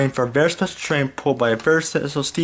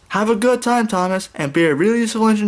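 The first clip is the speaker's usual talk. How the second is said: calling out; 8 semitones higher, 3 dB louder than usual. A narrator's reading. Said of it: "Sir Topham Hatt called out to Thomas".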